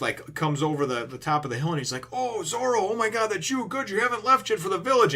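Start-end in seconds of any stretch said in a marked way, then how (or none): 2.1-5.1 high-pitched, excited acted greeting; excited calling out. Usopp spotting Zoro from the hilltop, excited calling out. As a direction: imitate an excited friend calling out.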